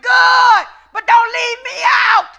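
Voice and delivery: loud, high divine warning; voice of God. Acted. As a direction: proclaim it as God. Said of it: "God said".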